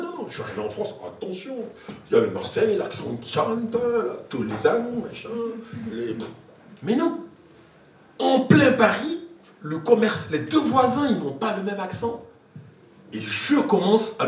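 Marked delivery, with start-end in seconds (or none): none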